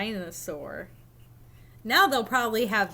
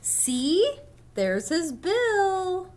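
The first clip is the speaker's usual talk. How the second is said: higher, slow pointing out; eager duck voice; 8 semitones higher, much slower than usual. Acted. Duck believer proves the bill, eager and slow. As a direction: show it off proudly.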